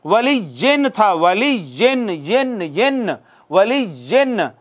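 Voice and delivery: "वली जिन" slow, with a drawn-out, sounded final n; तनवीन का नून दिखाकर. Shown as a demonstration. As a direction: hold the n at the end of "जिन". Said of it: "नून तनवीन था नाजरीन". ("वली जिन" के आख़िर का नून खींचकर)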